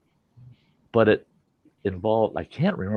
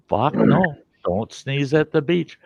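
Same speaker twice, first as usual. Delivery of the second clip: normal talking voice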